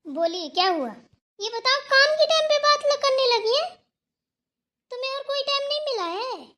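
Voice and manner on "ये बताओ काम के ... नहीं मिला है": high-pitched, indignant challenge; indignant scolding. Acted. Confronting her for chatting at work time, indignant scolding. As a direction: take her to task in a raised, high voice.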